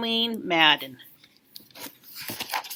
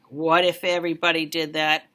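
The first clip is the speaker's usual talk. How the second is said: tender; 6 semitones lower than usual. A narrator's reading. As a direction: say it gently, touched. Tender ending after hug.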